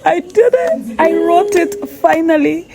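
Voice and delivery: high, bubbly cry of triumph; excited triumph. Retold or imitated. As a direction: squeal it out like you just won.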